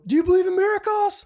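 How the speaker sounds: high, loud mock announcer call; hammed-up commentator shout; sportscaster call. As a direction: belt it like a famous sports call. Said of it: "I was like".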